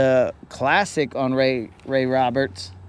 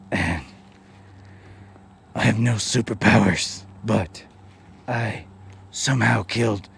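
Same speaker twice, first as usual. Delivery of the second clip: low, soft, airy Batman voice, winded; mock a breathless Batman running out of air; breathy Batman; 5 semitones lower, 4 dB quieter than usual. Mocking Batman as breathless and powerless, soft breathy voice. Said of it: "but a breathier voice".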